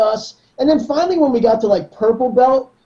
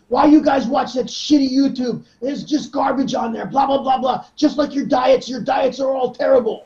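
put-on lightly accented, higher, ranting coach; Eduardo rant; 4 semitones higher than usual. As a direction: scold Americans about junk. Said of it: "he didn't want us watching YouTube".

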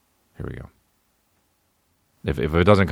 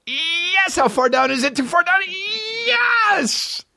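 much higher falsetto squeal of joy; mock excited squeal; much slower than usual. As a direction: shriek it like a giddy fan.

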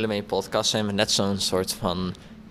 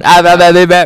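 mock shouting; 7 semitones higher, 14 dB louder than usual. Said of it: "Ernst schreeuwt ook zo".

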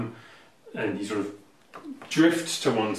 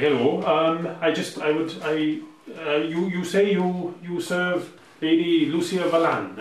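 put-on higher, louder Welsh-accented noble; 6 semitones higher, 5 dB louder than usual. Busybody Eladrin noble addressing the Aarakocra, in a Welsh accent. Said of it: "he's supposed to be Welsh".

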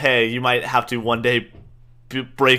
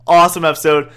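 usual speaking voice

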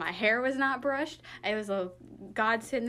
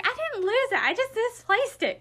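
high-pitched, louder comic self-defence; defensive protest; 13 semitones higher, 4 dB louder than usual. Retold or imitated. Wife retelling her protest to husband, defensive protest. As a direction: insist on the distinction in a raised voice.

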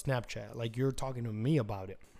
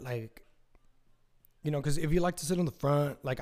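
usual speaking voice

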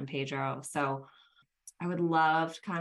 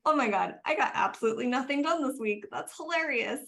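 high, fast, amused retelling of her own reaction; laughing it off; 9 semitones higher, 4 dB louder, faster than usual. Retold or imitated. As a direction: toss it off with a giggle in the voice. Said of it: "And I just laughed".

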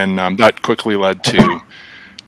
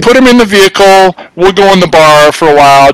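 higher, louder, breezy careless parent; mock seventies parent; 8 semitones higher, 6 dB louder than usual. Mocking seventies parents leaving kid in car, hammed-up careless voice. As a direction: toss off a careless grown-up line.

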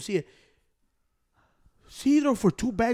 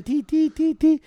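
high, repeated checkout beeps; imitated scanner beeps; 7 semitones higher, slower than usual. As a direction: mimic a scanner beeping, pitched up.

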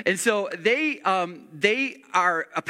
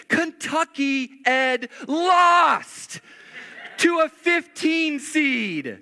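higher, louder, slow outraged shout; exasperated outburst; 7 semitones higher, 3 dB louder, much slower than usual. Real, not acted. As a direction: let the disbelief burst out. Pastor's mock outrage at bracket.

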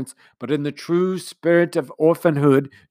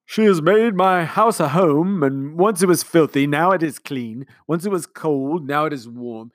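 lovesick; 4 semitones higher, 6 dB louder than usual. A narrator's reading. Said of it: "with a lovesick gaze".

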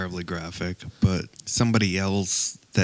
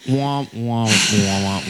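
droopy sung womp womp womp; sad trombone womp; slower than usual. Pretend. Friends joking about a disappointing show, singing a sad trombone womp. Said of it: "We need this sound".

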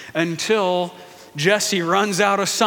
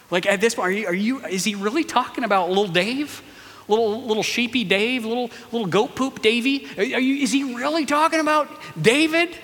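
higher, faster teasing voice; mocking big brothers; 4 semitones higher, faster than usual. Brothers mocking little brother David in a higher voice. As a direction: sneer at little goat poop Davy.

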